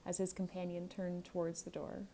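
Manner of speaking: usual speaking voice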